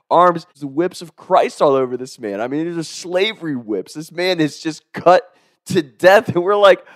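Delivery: ordinary delivery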